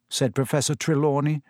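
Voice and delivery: ordinary delivery, nothing marked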